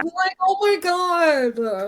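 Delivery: high, slow squeal of delight. Real, not acted. Friend squealing over a sweet book scene, high and slow. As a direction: squeal it out, overwhelmed by cuteness.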